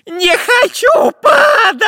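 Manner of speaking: very high, drawn-out crying scream; panicked wail. A narrator's reading. Spy scurrying along the branch, wailing in panic. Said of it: "заметался по ветке шпион Дырка".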